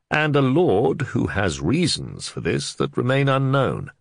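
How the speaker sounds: a country sergeant explaining to his lord; Catchpole. Acted. Sergeant explaining the case to his lord, rustic voice.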